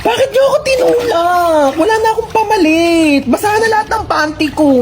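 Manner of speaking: high-pitched indignant wail; upset, high whining protest; whiny outrage. Acted. Pushed in, complaining.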